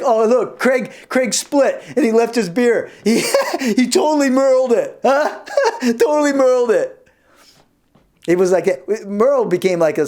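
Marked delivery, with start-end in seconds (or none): 0.0-7.1 higher, laughing tease; mock a friend while laughing; laughing mockery. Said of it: "they'd be like".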